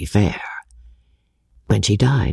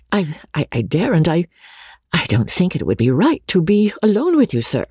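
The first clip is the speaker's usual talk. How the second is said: agitated; 6 semitones higher than usual. A narrator's reading. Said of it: "seemingly in great agitation".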